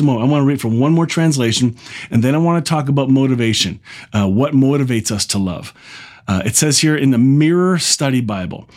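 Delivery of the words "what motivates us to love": normal talking voice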